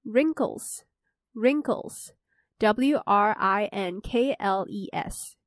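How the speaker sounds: each word said twice, then spelled out slowly letter by letter; slow vocabulary dictation. Shown as a demonstration. Host reviewing vocabulary for listeners, slow careful dictation. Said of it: "第二个就是wrinkles".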